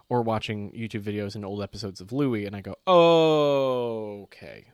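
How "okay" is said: loud, long-stretched "Okay"; acted dawning reaction, held long; drawn-out okay. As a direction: stretch the first vowel out in mock realization. Retelling reaction to a text exchange.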